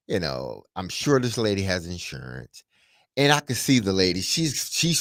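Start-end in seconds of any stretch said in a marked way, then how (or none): none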